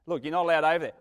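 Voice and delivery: hint of a stern teacher; mild stern teacher. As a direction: warn the kid off. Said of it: "the teacher said".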